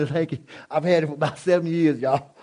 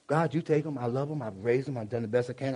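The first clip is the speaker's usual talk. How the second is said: soft, lower acted prayer; quiet prayer; 4 semitones lower, 6 dB quieter than usual. Parent handing children over to God in quiet prayer. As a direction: hush down as if praying.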